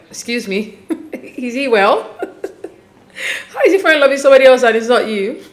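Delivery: laughing through a mock-baffled question; amused disbelief. Host imitating a daughter's disbelief, laughing. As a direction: chuckle between the questions, incredulous.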